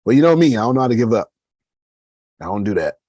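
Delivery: a hint of a defiant boast; emphatic retort. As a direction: tell him proudly, with force. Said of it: "I told him".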